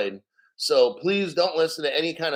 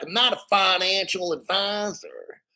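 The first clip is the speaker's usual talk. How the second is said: higher-pitched mock Southern accent; 4 semitones higher than usual. Streamer showing how people from the South talk, mock Southern accent. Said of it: "people from the South".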